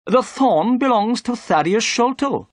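high-pitched, dismissive inspector; high character voice brushing off the question; quick reply. A narrator's reading. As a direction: answer promptly as the pompous inspector, pitched high. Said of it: "replied Jones quickly".

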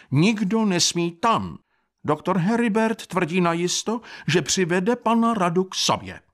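high, carrying official order; firm, projected announcement; public announcement. A narrator's reading. Official addresses the gathered crowd.

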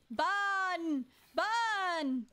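long drawn-out playful cry; mock-villainous chant, each one held; gleeful battle cry. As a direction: call it out, stretching each word. Cries out while burning things in game.

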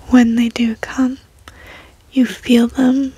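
ordinary talk

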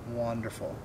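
ordinary delivery, nothing marked

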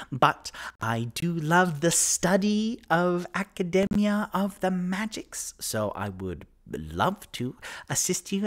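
high gushing bookseller, huge opening cry; impressed shopkeeper. Acted. Bookseller meets a fellow magic user, gushing and effusive. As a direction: burst out high and loud, then ease into eager chatter. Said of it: "And then she goes".

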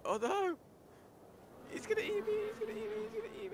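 very high, frightened squeal; high-pitched panic, loud then easing off; panicked squealing. Real, not acted. About to be eaten in game.

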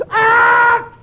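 shouted comic sneeze; big sneeze breaking the sentence; loud fake sneeze. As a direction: let the sneeze explode out.

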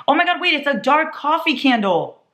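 high, delighted outburst; excited discovery. Real, not acted. Finding a coffee candle among gifts, excited discovery. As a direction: burst out in high-pitched delight.

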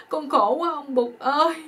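high, slow hammed-up wail; fake-sob a plea to the fairy; whimpering, drawn-out mock lament; mock crying. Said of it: "Nó khóc chắc không có thật tâm".

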